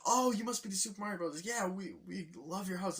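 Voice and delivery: high, bright-eyed homeowner greeting the plumbers; cheery client voice. Retold or imitated. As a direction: play the eager client way up high. Client voiced in movie retelling.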